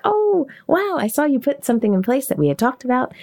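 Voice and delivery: high, delighted "oh, wow" reaction; impressed surprise. Acted. Acted voice note praising a client.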